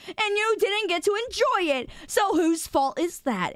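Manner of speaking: ordinary talk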